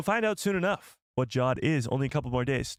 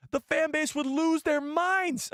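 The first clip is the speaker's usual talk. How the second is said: dramatic; slower than usual. Acted. Imagining outraged fans.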